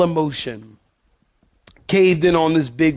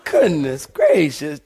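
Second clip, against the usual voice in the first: ordinary delivery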